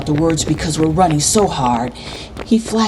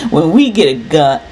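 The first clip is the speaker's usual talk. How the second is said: quick, loud boast cut short; boastful; 5 dB louder, faster than usual. A narrator's reading. Older boy brags to his friend, cocky. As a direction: blurt it out fast and forcefully. Chris boasts, then stops.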